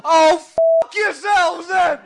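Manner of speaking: a broken diplomat screaming from the bins; drunken Kofi Annan. Retold or imitated. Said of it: "found pissed amongst the bins going".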